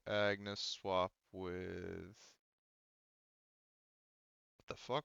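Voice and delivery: ordinary talk, nothing marked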